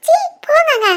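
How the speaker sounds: high, bashful brush-off; wife coyly shooing her husband away; coy protest. Acted. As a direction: push him away playfully. Wife embarrassed by confession.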